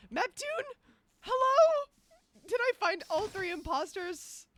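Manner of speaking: loud, high calling to someone far off; loud hey-is-anyone-there call. Real, not acted. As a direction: shout to get their attention. Calling out to others after dying.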